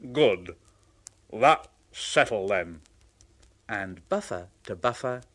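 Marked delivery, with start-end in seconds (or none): 0.1-2.8 low, firm big engine. Acted. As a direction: settle it firmly. Gordon voiced.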